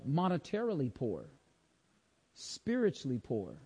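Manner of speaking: soft, slow, weighty preacher's voice; hushed preaching. Real, not acted. Preacher asking congregation about spiritual poverty, hushed preaching. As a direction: drop the volume and let each phrase sink in.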